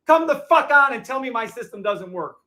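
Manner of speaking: ordinary talk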